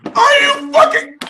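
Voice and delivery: very high, stretched, loud cry; disbelieving wail. Pretend. Reader losing it at the card, high wail. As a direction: cry out in mock despair.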